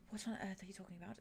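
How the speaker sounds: fast, hushed disbelief; a rushed, breathless whisper of disbelief; incredulous whisper. Retold or imitated. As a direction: whisper it quickly, baffled. Baffled reply retold to Arte.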